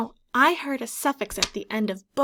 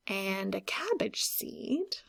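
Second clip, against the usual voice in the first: whisper; 3 semitones lower, 6 dB quieter, slower than usual. Acted. Reading the book aloud.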